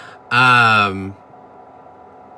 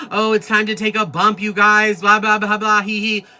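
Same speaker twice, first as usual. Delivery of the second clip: mock catchphrase; 9 semitones higher than usual. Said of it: "this fucking bump situation".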